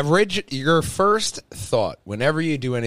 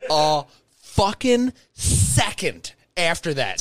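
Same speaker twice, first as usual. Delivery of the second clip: ordinary delivery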